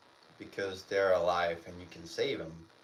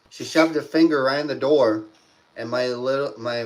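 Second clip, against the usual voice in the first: usual speaking voice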